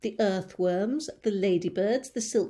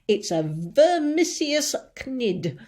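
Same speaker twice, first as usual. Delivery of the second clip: louder, slow alarmed cry; cried out; 5 semitones higher, 7 dB louder, slower than usual. A narrator's reading. Police chief crying out the monster's name. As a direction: announce the horror slowly. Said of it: "cried the chief of police".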